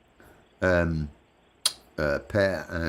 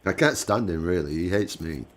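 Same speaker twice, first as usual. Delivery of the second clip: ordinary talk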